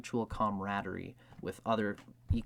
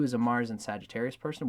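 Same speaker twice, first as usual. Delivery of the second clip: normal talking voice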